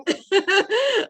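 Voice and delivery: high delighted burst of laughter. Real, not acted. Host laughing after quoting the poem, high laughter.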